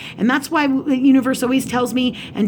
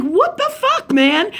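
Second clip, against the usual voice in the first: very high, indignant outburst; exasperated, voice leaping up; retold pissed-off; 10 semitones higher, 3 dB louder, slower than usual. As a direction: re-enact outraged disbelief. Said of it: "'Cause I was pissed".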